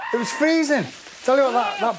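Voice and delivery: excited. Real, not acted. Recalling the freezing ice water.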